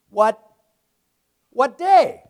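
high, loud questioning; rhetorical challenge. Acted. Preacher challenging the congregation to answer.

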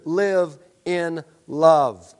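each word of "live in love" drawn out slowly and leaned on; slow emphasis. Acted. Preacher closes a quote.

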